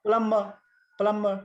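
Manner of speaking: प्लम्ब and प्लम्बर with silent B; correct pronunciation. Shown as a demonstration. Teacher modelling for students, प्लम्ब and प्लम्बर without the B. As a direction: say प्लम्ब, प्लम्बर as plum-ma, twice. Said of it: "बी भन्दैन".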